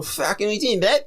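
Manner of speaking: badly faked Cockney-style London voice; bad London accent. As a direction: have a go at London and miss. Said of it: "No, that was London".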